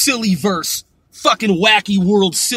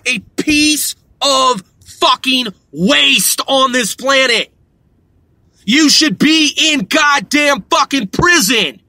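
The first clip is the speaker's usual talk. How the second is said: furious shout; 4 semitones higher, slower than usual. Real, not acted. Ranting at scam robocallers.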